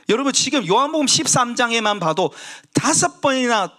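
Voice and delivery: high, ringing sermon climax; fervent preaching. Acted. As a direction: preach it out, pressing the hall. Preaching at full force.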